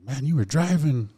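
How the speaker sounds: incredulous cousin, pitched well up; retold amazement. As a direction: imitate someone astonished you don't remember. Cousin amazed he remembers nothing.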